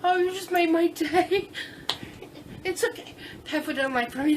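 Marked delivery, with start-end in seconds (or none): none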